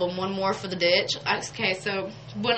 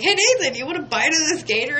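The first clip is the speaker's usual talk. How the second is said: much higher and louder, bubbly offer; cheery offer; 8 semitones higher, 5 dB louder than usual. Retold or imitated. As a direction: call out brightly to the cellist. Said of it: "I was like".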